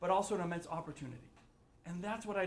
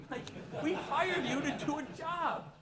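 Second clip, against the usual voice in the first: slowed, indignant call-out; exasperated rant; 8 semitones higher than usual. Acted. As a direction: drag it out like scolding hired help. Mock rant at Washington.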